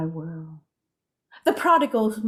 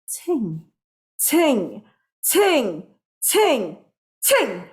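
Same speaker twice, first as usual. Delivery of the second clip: crescendo; 4 semitones higher, 5 dB louder, much slower than usual. Shown as a demonstration. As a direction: build each ting louder. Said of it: "Crescendo, pianissimo to fortissimo".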